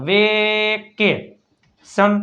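drawn-out syllable by syllable; slow sounded-out word. Shown as a demonstration. Teacher blending letters into a word slowly. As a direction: let the word stretch so kids hear each sound. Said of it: "સી એટલે ક".

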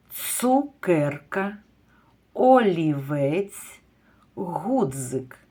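each word drawn out slowly; slow careful words for preschoolers; slow careful word list. Shown as a demonstration. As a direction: read the words slowly for children to hear the sounds. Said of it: "Послухайте наступні слова".

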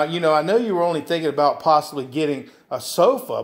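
ordinary talk, nothing marked

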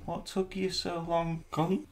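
put-on high, slow, a light Irish accent; a hint of Irish Qui-Gon; mock Irish accent, lightly done. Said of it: "an Irish kind of maybe accent".

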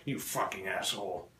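low, quiet acted curse; annoyed retort. Comedian replying to drunk friend who knocked over cup, annoyed. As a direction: curse under the breath, only mildly annoyed.

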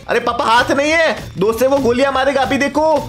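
put-on high, pressing kid's voice; urgent son. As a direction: play the son urging papa to act.

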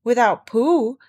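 loud, grand pronouncement; solemn. A narrator's reading. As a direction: declare it with great weight. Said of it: "said Rabbit solemnly".

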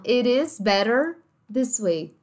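slow "It is better this way", Ts flapped; American linking. Shown as a demonstration. Teacher repeating what Americans say, slow flapped "It is better". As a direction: run "It is" together, flap the T in "better".

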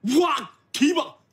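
high excited cheer. Acted. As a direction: shout with delight. Ticket for three.

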